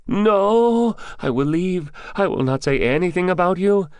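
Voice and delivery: high, loud frightened pleading; terrified, high-pitched captive voice; panicked babbling. A narrator's reading. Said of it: "Remen babbled".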